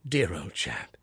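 soft, hushed fatherly whisper; tender whisper. Acted. Father soothing his son in a tender whisper. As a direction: hush it to your boy gently. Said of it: "with a very tender hand".

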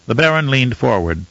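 ordinary delivery, nothing marked

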